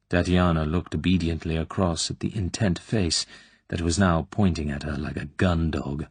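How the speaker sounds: plain reading voice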